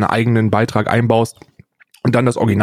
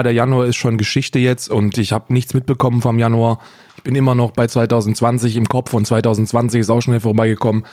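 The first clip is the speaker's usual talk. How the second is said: ordinary talk, nothing marked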